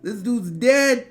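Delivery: rising into a loud, high panicked yell; yelling in fright that the man is dead; panicked shout. Retold or imitated. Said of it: "And I was like".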